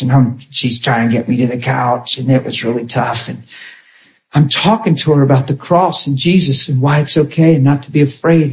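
normal talking voice

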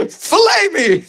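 high-pitched comic pleading; eager fish voice. Pretend. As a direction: beg in a silly high fish voice. Voices fish leaping into the boat.

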